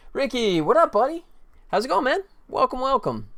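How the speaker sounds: high-pitched, upbeat hello to a buddy; excited greeting. Real, not acted. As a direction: greet a friend high and eagerly. Greets a viewer who just arrived.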